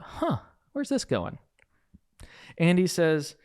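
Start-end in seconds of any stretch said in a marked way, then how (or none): 0.0-1.4 high-pitched, puzzled inner thought; suspicious little "huh". Retold or imitated. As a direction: wonder aloud, pitched well up. Puzzled thought during the debrief.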